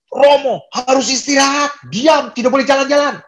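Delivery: high-pitched imitation of a shouting doctor; mock shouting. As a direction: shout orders at a disobedient patient, hammed-up. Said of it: "dia itu teriak-teriak".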